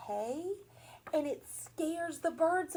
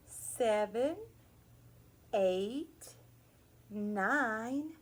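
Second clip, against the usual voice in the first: ordinary delivery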